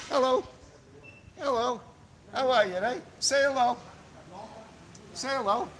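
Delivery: sweet pet talk to a kitten; cat talk. Real, not acted. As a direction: coo at the street cat. I'm a big softy when it comes to pussycat.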